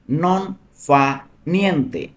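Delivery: "নন", "ফা", "নিয়েন্তে" split apart with a pause after each; word by word. Shown as a demonstration. Teacher splitting "নন ফা নিয়েন্তে" into single words for students. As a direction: break the phrase into separate words so learners catch each one. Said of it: "teacher drills Italian phrase".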